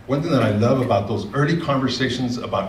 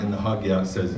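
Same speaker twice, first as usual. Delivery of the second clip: ordinary talk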